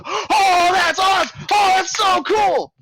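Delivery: high, loud, delighted exclamations; amazed crowd. Acted. Convention crowd cheering the juggling bear, high excited shouts. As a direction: gasp in awe at the juggling bear.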